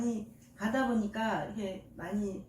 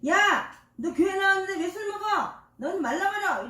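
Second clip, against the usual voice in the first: higher, louder scolding; mock divine rebuke; 7 semitones higher, 4 dB louder than usual. Jesus to a drinking churchgoer, scolding.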